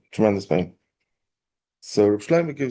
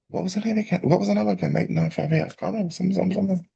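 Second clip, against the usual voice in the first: high-pitched, fretful recall of a number; high voice of a panicking forgetful suitor; flustered forgetting; 9 semitones higher, faster than usual. Acted. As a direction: act out groping for a forgotten number, pitched way up. Boy struggles to recall forgotten number.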